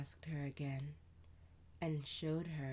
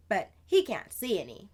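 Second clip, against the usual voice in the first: higher, louder, quick big-sister voice; older sister voice; 6 semitones higher, 13 dB louder than usual. Acted. Sister answering Amani, lively and louder. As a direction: play the lively older sister telling a tale.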